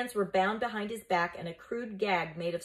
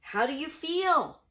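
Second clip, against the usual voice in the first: slow, higher anxious question; concerned; 9 semitones higher, 5 dB louder than usual. Acted. Mucius bending over his freed teacher, asking with concern. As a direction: ask with worried care, drawing it out. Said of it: "asked with concern".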